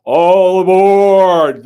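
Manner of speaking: long, drawn-out loud call of all aboard; conductor's shout. Acted. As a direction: bellow it down the platform, slowly. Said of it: "the conductor cried out".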